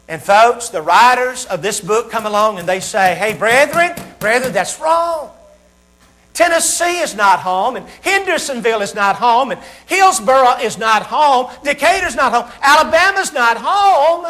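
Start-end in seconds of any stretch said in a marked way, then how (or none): 3.1-14.2 high, drawn-out list, climbing with each place; emphatic correction. Acted. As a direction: hammer each "not home", building higher to the last. Bible writers correcting the brethren.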